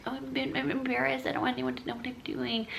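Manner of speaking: small, high, soft inner voice; mock embarrassed. Streamer voicing her secret embarrassment, small high mumble. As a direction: squeak it quietly as if mortified.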